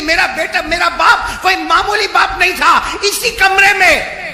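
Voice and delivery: loud, high fire-and-brimstone sermon; shouted preacher's exhortation; full-force preaching. Acted. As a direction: thunder the warning at the whole crowd.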